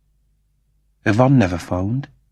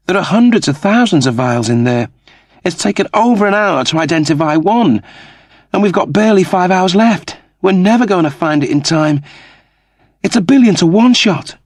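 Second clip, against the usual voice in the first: higher, rising louder toward the end; despairing Kachansky; 10 semitones higher, 4 dB louder than usual. Acted. Kachansky tells Crichton it's hopeless, voice climbing higher and louder. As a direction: build from worry into near panic. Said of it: "Kachansky pulled on her earlobe".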